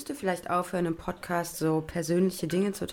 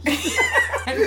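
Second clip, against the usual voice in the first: giggling fit; loud laugh; 5 dB louder than usual. Real, not acted. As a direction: burst of high laughter. Friends recalling funny scene.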